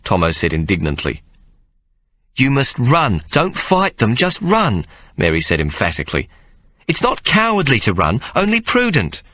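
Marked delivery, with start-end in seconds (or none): 2.4-9.2 higher, firm motherly insistence; emphatic mother Mary. Acted. Mary urging her sons to run, higher firm voice. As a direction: press the point like a worried mother.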